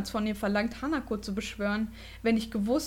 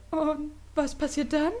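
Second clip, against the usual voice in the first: pale, frightened question; 5 semitones higher than usual. A narrator's reading. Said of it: "fragte sie mit bleichem Gesicht".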